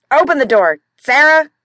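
loud, high, impatient yell at the door; shouting through the door. Acted. Husband locked out, yelling for his wife to open up, loud and impatient. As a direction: bellow to be let in, fed up.

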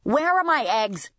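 irate customer. A narrator's reading. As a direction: demand your order.

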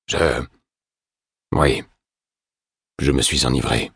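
a hint of a guilty drunk; ashamed confession. A narrator's reading. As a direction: force the words out in shame. Ashamed drunk admits fault.

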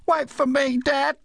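put-on high-pitched little kid calling to Dad; child calling after. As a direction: call out high like a child left behind. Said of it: "heard the voice from some distance behind him".

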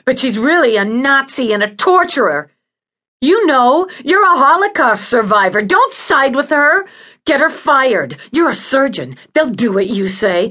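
high, loud venomous tirade; angry rant. Acted. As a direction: accuse furiously, building to a loud demand. Patient rants against ward head.

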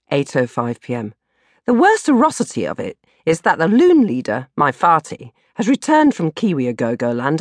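ordinary delivery, nothing marked

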